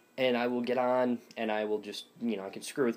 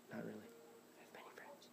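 whispered aside; 25 dB quieter than usual. Pretend. Joking to viewers about being lonely, tiny whisper. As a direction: whisper it far under your breath.

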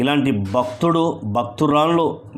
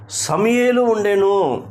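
raised, slow proclamation of the answer; emphatic answer; 7 semitones higher than usual. Real, not acted. Preacher answers his question about who prayed, emphatic and high. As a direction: ring it out high and drawn out.

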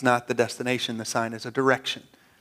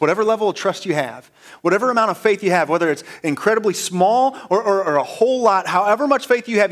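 raised, louder preacher's exhortation; impassioned preaching; 6 semitones higher, 5 dB louder than usual. Real, not acted. Preacher urging listeners to trust Jesus, impassioned. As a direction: urge them on in a rising, driving run.

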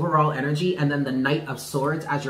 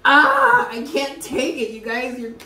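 real high-pitched, overwhelmed wail; crying; 9 semitones higher, 3 dB louder than usual. Real, not acted. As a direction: cry out high, then fade as emotion takes over. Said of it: "You're making me cry again".

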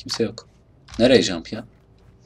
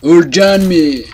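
combat yell; 8 semitones higher, 12 dB louder than usual. Real, not acted. Player in a firefight, high loud yell. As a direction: yell it at the enemy.

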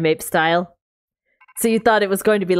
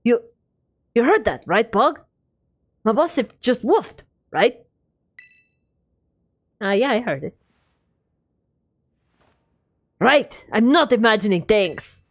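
put-on Russian accent. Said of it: "I did all of Arven's scene in Russian accent".

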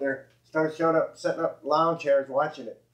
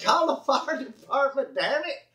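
retold shout; 9 semitones higher than usual. Retold telling boy to call firemen.

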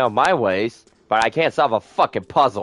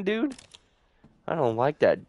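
ordinary talk, nothing marked

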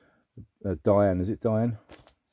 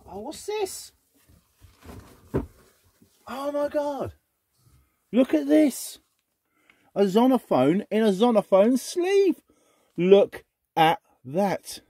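much higher, excited gasps of discovery; thrilled surprise; 12 semitones higher, 3 dB louder, slower than usual. Real, not acted. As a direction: squeal over a treasure you just found. Thrilled by a rare record find.

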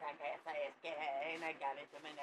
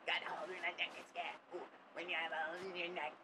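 usual speaking voice

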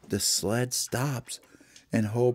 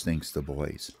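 ordinary delivery

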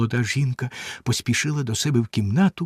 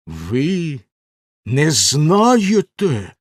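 menacing; 3 semitones higher, 6 dB louder, much slower than usual. A narrator's reading. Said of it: "грізно перепитав".

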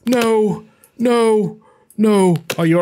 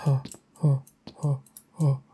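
whisper; 13 semitones lower, 8 dB quieter, faster than usual. Real, not acted. Hiding quietly from monster.

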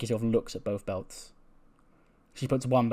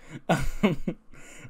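amused laugh; 6 semitones higher than usual. Real, not acted. Laughing at game text.

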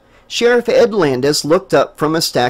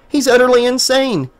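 incredulous; 8 semitones higher than usual. A narrator's reading. Jessica's thought.

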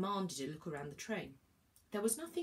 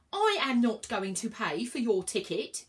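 louder, slow angry refusal; shouting; 4 semitones higher, 7 dB louder than usual. A narrator's reading. Inspector refuses to pay, shouting. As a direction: put your foot down hard. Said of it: "shouted the man".

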